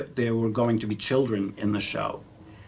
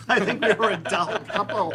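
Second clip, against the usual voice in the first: laughing; 10 semitones higher than usual. Real, not acted. Joking about the dull couple.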